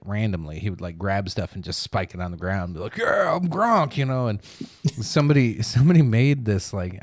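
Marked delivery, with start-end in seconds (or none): 3.0-4.0 rowdy, raised meathead holler, drawn out; Gronk. Retold or imitated. Retelling a football player spiking stuff, rowdy mock holler. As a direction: mimic a hammered guy going crazy. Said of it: "he was just going crazy".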